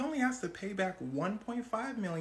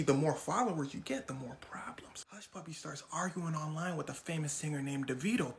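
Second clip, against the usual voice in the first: usual speaking voice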